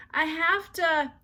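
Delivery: put-on high, loud, slow childish whine; desperate child voice. A narrator's reading. Ramona begging her mother, high and slow. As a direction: whine it like a desperate four-year-old. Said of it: "insisted Ramona even more urgently".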